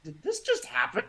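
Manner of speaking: very high-pitched, stunned questioning; frozen disbelief. Retold or imitated. Blind man re-living his cane being snatched, frozen in disbelief. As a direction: act out being stopped dead by shock. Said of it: "I just froze".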